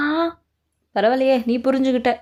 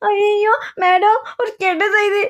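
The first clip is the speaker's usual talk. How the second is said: crying; 6 semitones higher, 3 dB louder, slower than usual. Acted. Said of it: "ஏன் இப்படி அழற?".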